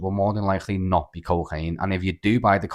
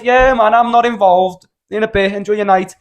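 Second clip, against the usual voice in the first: much higher, louder breezy retold yell; shouting from the window; 11 semitones higher, 8 dB louder than usual. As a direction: brush him off in a shout from the window.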